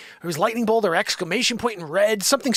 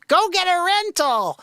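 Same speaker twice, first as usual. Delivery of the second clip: much higher, slow, sneering parody; mocking quote; 12 semitones higher, 4 dB louder, slower than usual. Owner mocking the dealer's advice, sneering parody. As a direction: drag it out to mock them.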